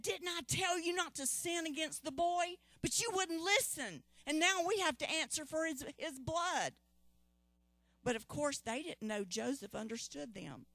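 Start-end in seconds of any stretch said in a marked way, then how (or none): 0.0-6.7 much higher, shouting rebuke of his brothers; angry reproach. Acted. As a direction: shout it: I told you so. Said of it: "And Reuben said".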